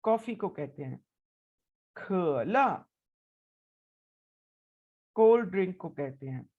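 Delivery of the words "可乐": 可乐 said with clear Mandarin tones; teacher demo of 可乐; careful Mandarin model. Shown as a demonstration. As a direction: model 可乐 slowly for learners. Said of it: "इसकी practice करेंगे".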